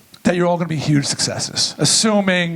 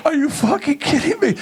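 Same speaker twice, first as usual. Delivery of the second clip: disbelief; 9 semitones higher than usual. Retold or imitated. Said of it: "I said".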